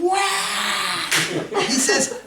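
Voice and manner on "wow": amazed wow. Retold or imitated. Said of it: "he was going".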